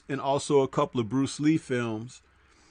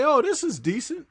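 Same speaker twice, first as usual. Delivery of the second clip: raised, lightly surprised approval; mildly impressed viewer; 11 semitones higher than usual. Retold or imitated. Viewer who doesn't know the actors, mildly impressed. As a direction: sound pleasantly surprised by the film. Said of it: "you could say".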